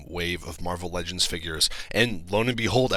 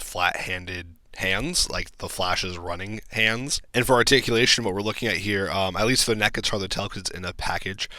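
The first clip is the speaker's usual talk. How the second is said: normal talking voice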